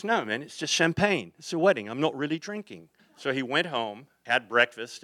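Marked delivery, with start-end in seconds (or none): 0.0-2.9 higher-pitched English drummer impression; imitating a rocker brushing off an objection. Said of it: "He says".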